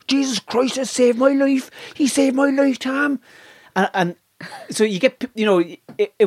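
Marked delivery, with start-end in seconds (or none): 0.1-3.2 high-pitched Cork born-again believer; Cork accent. Retold or imitated. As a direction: testify fervently in a high Cork lilt. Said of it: "This fellow from Cork".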